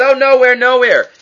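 high, feigned innocence; innocent denial. Retold or imitated. Gehazi denying to Elisha, high innocent voice. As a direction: play dumb, voice up high.

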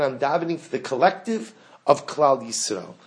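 usual speaking voice

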